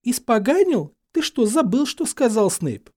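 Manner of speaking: high, loud disbelief; indignant, high-pitched reproach; appalled scolding. A narrator's reading. Hermione alarmed, scolding Harry.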